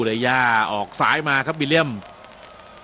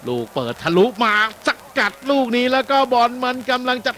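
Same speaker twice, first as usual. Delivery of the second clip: quick, raised live match call, pitch up; excited play-by-play; 7 semitones higher, 3 dB louder than usual. Real, not acted. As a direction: call the attack as it happens. (พากย์บอลสดอย่างตื่นเต้น เสียงสูงขึ้น)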